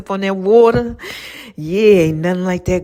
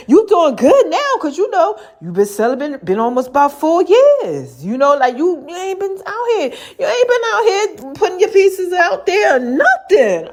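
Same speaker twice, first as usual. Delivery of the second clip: much higher, laughing mock voice of God; giggling high-pitched God; teasing God voice; 11 semitones higher than usual. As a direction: play God teasing her, laughing through it. God quoted, teasing her.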